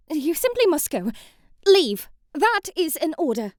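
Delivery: a haughty princess barking an order; commanding order. Acted. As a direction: dismiss her with authority.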